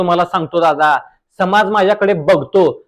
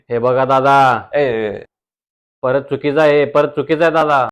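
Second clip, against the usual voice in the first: reel star guest voice; 7 semitones lower than usual. Acted. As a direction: play the smug village reel star. (Reel स्टारचा आवाज)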